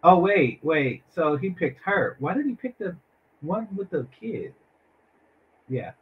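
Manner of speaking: usual speaking voice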